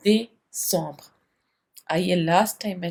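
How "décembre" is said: décembre with a "day-sombr" sound, not दिसंबर; French décembre. Shown as a demonstration. Teacher contrasts décembre with Hindi दिसंबर. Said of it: "दिसंबर मत बोलिएगा French में".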